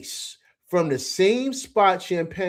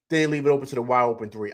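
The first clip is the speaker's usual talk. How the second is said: normal talking voice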